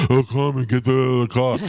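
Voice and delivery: breathy mocking imitation. Carmen mimicking how he talks to her, breathy mock voice. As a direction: mimic him as a winded oaf. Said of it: "I love how breathy".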